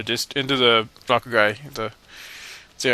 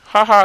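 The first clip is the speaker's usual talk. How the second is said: loud, higher goofy laugh; silly laugh; 9 semitones higher, 9 dB louder than usual. Real, not acted. Laughing at the swordsman in the game, loud goofy ha ha. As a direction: belt out a goofy ha ha. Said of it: "I like my laughter too".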